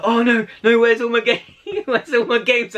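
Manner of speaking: high, loud, half-laughing mock panic over lost games. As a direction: squeal it like a friend who has just realised his bag is gone. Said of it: "freaking out".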